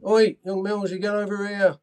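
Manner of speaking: loud gaffer's call across the dressing room; shouting for the young lad. Retold or imitated. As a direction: bark it across the room like the boss. Manager calling him over.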